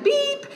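high, short imitated electronic beep; smoke alarm beep. Pastor imitating a smoke detector, high beep. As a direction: chirp it like a dying smoke detector. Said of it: "and it's like".

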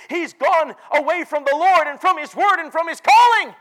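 high, loud lament; anguished preaching shout. Acted. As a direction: cry it out from the pulpit. Anguished pastor's report, preached loud.

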